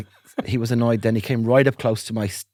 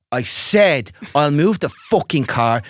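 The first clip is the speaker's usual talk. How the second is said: louder, higher furious yell; angry shout; 6 semitones higher, 5 dB louder than usual. Retold or imitated. Man yelling at his threatening neighbour, loud angry shout. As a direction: let it all out at him, swearing.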